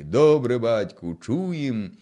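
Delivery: a loud roared answer from the fighters; roaring reply. Acted. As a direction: bellow the answer back to the leader. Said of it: "Заревіли гайдамаки".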